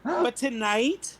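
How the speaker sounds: slow, stretched stage tease; dramatic drawn-out. Acted. Bard teasing the crowd before her song, slowly. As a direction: draw it out for suspense.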